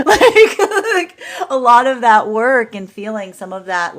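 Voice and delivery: loud, high words through real laughter; laughing. Real, not acted. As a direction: start in a squeal of laughter, settling as you go. Cracks up after quoted remark.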